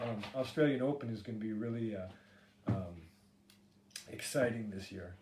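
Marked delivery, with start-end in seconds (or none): none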